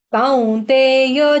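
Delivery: कौन्तेय opened like English cow; chanted कौन्तेय with a two-part vowel; diphthong demo. Shown as a demonstration. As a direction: stretch कौन्तेय as kaun-te-ya, gliding au. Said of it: "जैसे सी O W".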